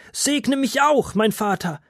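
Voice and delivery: high, slow, pleading cry; desperate cry. A narrator's reading. Esau begs his father for a blessing, crying out desperately. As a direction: beg with everything you have left. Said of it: "schrie er verzweifelt".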